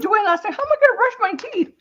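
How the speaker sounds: retold panic. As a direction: plead it in a high panicky rush. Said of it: "I said".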